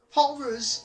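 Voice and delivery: very high, loud cry of horror; horrified exclaim. A narrator's reading. Thomas crashing off the rails, crying out in horror. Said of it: "he exclaimed".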